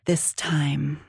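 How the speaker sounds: whispered, barely voiced; breathy whisper. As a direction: breathe it out softly. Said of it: "I breathed".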